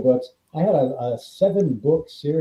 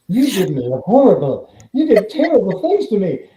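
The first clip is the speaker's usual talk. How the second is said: rising, louder accusation, imitating a friend; mock-aggrieved complaint; 6 semitones higher, 6 dB louder than usual. Friend scolds writer over her character, mock-aggrieved complaint. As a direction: pile on the mock accusations, pitch climbing.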